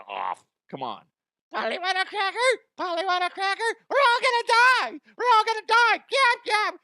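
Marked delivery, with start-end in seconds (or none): 1.5-3.6 high squawky parrot voice; mock parrot squawk. Imitating parrot on a plane. 3.9-6.8 high, loud squawked panic; shrieking parrot mock panic; screeching parrot. Imitating parrot squawking on plane.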